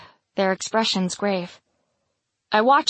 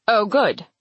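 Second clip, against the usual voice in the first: sarcastic. A narrator's reading. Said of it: "Vale says with tight sarcasm".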